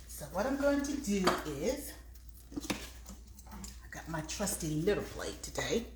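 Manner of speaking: normal talking voice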